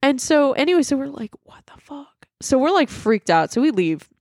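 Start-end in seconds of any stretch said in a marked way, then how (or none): 1.4-2.1 whispered disbelief, no voice at all; stunned whisper. Retold or imitated. Storyteller replaying the roommates' shock at the haunting, stunned whisper. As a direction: whisper it as a hushed reaction. Retold stunned reaction to the app.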